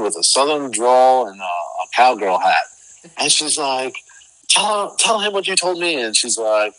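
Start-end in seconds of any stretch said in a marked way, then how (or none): 4.5-6.0 high, excited push to tell; bursting to share the news; wife urging stranger to repeat news, high excited; eager urging. Retold or imitated.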